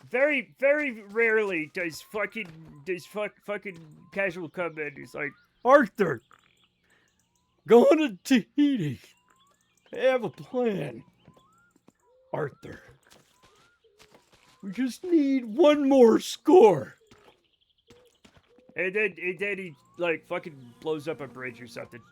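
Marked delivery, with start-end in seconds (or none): 5.6-16.9 loud, slow, put-on gang leader voice; a hammed-up outlaw boss pitching his plan, drawn out and booming; bad Dutch Van der Linde impression. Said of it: "I love my Dutch Van der Linde impression because it's bad".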